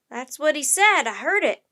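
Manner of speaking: emphatic, loud sailor voice; loud testimony. A narrator's reading. Crewman backs up Annie, exclaiming loudly. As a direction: call it out with conviction. Amazed sailor confirms the words.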